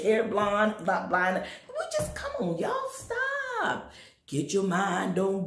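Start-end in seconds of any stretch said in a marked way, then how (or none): 1.7-3.9 high pleading cry. Acted. Preacher pleading with the church to stop, high.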